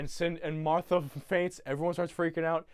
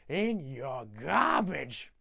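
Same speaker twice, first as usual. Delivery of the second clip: very slow, hammed-up repeat; drawn-out emphasis; much slower than usual. Grinch hammering it home to the Whos, slow drawl. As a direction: stretch every word theatrically.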